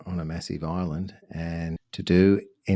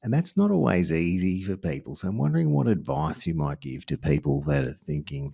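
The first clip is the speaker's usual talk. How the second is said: usual speaking voice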